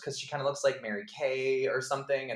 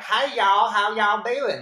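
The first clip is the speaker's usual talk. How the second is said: hammed-up Southern accent, much higher and louder; mock the actress's sudden Southern accent, belting it out; imitating a campy Southern accent in a loud, high voice; over-the-top Southern accent; 11 semitones higher, 11 dB louder, slower than usual. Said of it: "this really intense accent that came out of nowhere".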